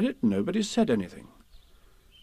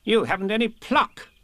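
higher, firm reproach; lightly voiced stern Rabbit; 10 semitones higher than usual. Rabbit reproaches timid Piglet, lightly stern. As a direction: scold lightly, matter-of-fact.

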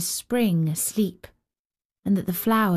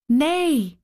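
put-on higher child's reply; 5 semitones higher, 3 dB louder than usual. A narrator's reading. Child refusing the giant's revenge, higher voice. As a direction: answer as the child, pitched higher.

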